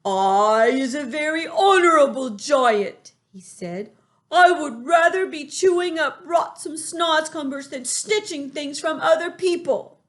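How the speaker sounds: high, loud dignified pride; proud giant. A narrator's reading. Giant proudly refuses to steal vegetables. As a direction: stand tall on your honour. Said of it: "raised his great head proudly".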